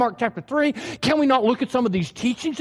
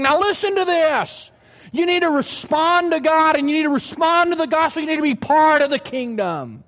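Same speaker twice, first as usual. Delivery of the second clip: higher, punchy insistence; emphatic command; 5 semitones higher than usual. Acted. Jesus jabbing his finger at listeners, emphatic and raised. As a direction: jab every word like a finger in the air.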